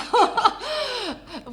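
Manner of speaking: a loud laugh leaping up high. Real, not acted. Laughs at "so many experience.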